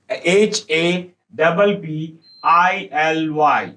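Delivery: letter by letter, slow dictation pace; slow spelling. Shown as a demonstration. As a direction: spell each letter slowly and clearly. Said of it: "slow spelling aloud".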